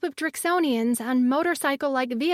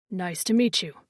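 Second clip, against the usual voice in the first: deep male voice; 7 semitones lower than usual. A narrator's reading. Rexor greets kindly, deep voice.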